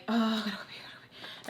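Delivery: acted desperate plea fading into a whisper; mock desperation, trailing into breath; urgent whispering.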